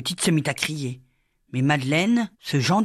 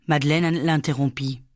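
usual speaking voice

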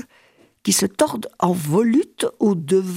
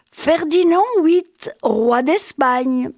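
titre crié lentement, plus haut; héler lentement, solennel; appel; 6 semitones higher, slower than usual. Retold or imitated. L'inquisiteur appelle encore. (l'inquisiteur l'appelle, lent et fort)

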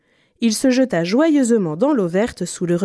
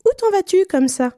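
very high, piping bird voice; whistling little bird; 12 semitones higher than usual. A narrator's reading. Little bird asking Pierre where he goes, high and chirpy. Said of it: "lui siffla-t-il".

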